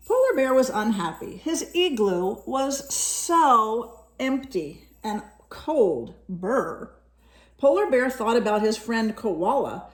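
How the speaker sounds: lively storybook reading voice; reading to children. A narrator's reading. Parent reading a picture book to a child, lively storytelling. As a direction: read it brightly to a young listener.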